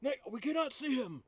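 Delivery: high-pitched character giving orders; commanding character. Acted. Player tells companion to hide the body, commanding character voice.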